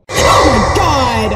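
loud, slow, frightened scream; drawn-out startled shout; real scream. Real, not acted. Second jump scare.

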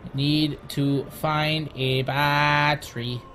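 slow, stretched-out words; drawn out. Pretend. Player hunting for a battery, drawn out. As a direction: take it at half speed.

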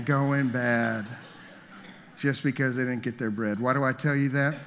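usual speaking voice

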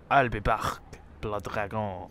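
put-on low Terminator-style Austrian accent. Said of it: "not, not Austrian".

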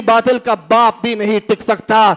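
ordinary talk

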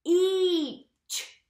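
segmenting B-each playfully; stretched word. Shown as a demonstration. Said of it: "Let's stretch out the word beach".

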